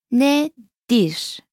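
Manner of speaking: Nedir split slowly into ne, dir; syllable-by-syllable model. Shown as a demonstration. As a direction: say Nedir with a pause between its two syllables. Teacher splits syllables, then says normally.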